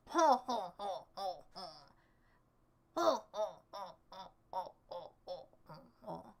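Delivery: fading ho ho laugh. Pretend. Streamer drifting into a Santa laugh, fading it out. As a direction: let a Santa ho-ho die out.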